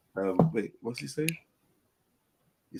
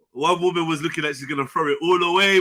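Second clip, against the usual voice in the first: usual speaking voice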